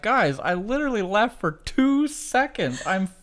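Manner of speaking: high, loud exasperated scolding; exasperated parent. Retold or imitated. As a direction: act out a fed-up mom telling off the kids. Mother acts scolding squabbling kids.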